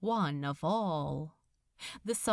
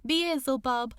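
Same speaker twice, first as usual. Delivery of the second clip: usual speaking voice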